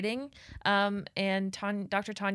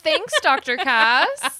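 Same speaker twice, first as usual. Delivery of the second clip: sarcastic; 3 semitones higher, 6 dB louder, slower than usual. Real, not acted. Teasing friend after cliché.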